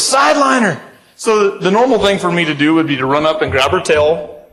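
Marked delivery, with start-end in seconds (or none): 0.0-0.8 much higher, barked command; urgent order. Retold or imitated. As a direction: snap the order out high. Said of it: "he goes".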